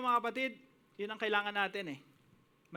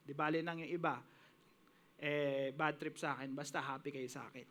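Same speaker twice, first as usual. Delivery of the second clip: quiet devoted prayer; 6 semitones lower, 4 dB quieter than usual. Retold or imitated. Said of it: "Nabubuhay ka parang".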